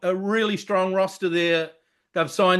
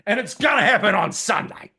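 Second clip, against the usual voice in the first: ordinary talk, nothing marked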